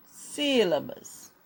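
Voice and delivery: SÍLABAS said slowly as one word; slow, falling SÍLABAS for writing; measured dictation. Shown as a demonstration. Teacher dictates while writing. (SÍLABAS ditado devagar)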